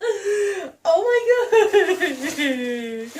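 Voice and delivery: slow, gasping, laughing near tears; gasping surprise. Real, not acted. Vlogger finding who sent the gift, gasping in surprise. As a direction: gasp and laugh, overwhelmed. Overwhelmed reading note.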